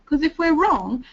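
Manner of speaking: ordinary delivery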